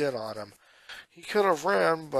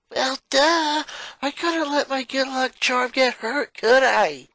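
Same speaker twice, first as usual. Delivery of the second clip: put-on high, louder, teasing kid-sister voice; cocky tomboy; 10 semitones higher, 6 dB louder than usual. Sister shrugging off her rescue to her brother, cocky tease. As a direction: brag it off like it's nothing. Lana voice in story.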